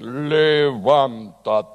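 lightly voiced booming God voice; deep voice of God. Pastor recalls recording God's voice in a low, slow command. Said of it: "busqué yo el tono más grave".

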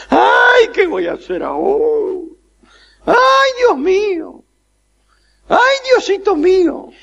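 agudo y alto, quejumbroso; lamento desesperado. Acted. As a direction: gime como quien se arranca los pelos. (lamento agudo y desesperado)

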